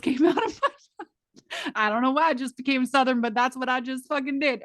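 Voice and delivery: high, loud amused disbelief through laughter; helpless laughing outburst. Real, not acted. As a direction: crack up at your own slip. Cracking up at own accent slip.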